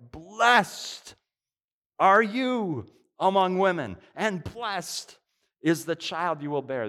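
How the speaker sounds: high, loud exclamation full of the Spirit. Acted. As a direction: proclaim it out loud, lifted high. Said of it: "in a loud voice".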